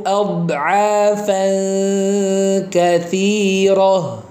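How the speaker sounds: slow melodic tajwid chant; Quran recitation. Shown as a demonstration. Teacher reciting the verse for students. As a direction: recite the verse slowly, drawing out vowels.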